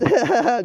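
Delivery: a loud, high cackle; loud laugh. Real, not acted. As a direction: cackle loudly mid-sentence. Laughs mid-sentence.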